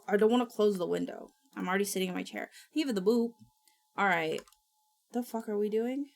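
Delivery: normal talking voice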